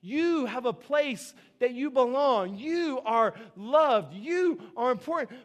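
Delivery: slow, gentle words of worth; tender reassurance. Acted. Pastor telling the lost young worker he matters, slow and tender. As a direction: speak it slowly like a hand on his shoulder. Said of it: "bring people like him close to us and we say".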